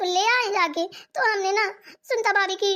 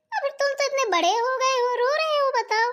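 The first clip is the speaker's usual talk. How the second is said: put-on teasing baby talk; 6 semitones higher than usual. Teasing the grown girl for crying.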